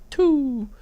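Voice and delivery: high, playful imitation of a tiny child; toddler voice. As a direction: answer proudly as a little toddler would. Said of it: "She goes".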